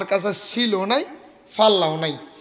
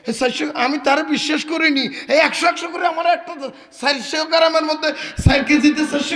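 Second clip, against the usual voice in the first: higher, raised angry rant; furious shopkeeper; 6 semitones higher than usual. Acted. Shopkeeper raging at the seller who cheated him, angry shout. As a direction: lash out at the cheat.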